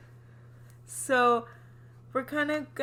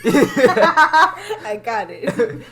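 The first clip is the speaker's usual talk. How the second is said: laughing; 10 dB louder, much slower than usual. Real, not acted. Joins in singing, laughing.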